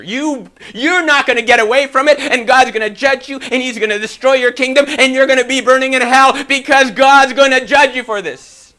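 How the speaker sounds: high, shouted hellfire judgment; loud, relentless mock tirade; furious denunciation. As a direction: rant like an outraged prophet condemning a king. Acted defiant answer they never gave.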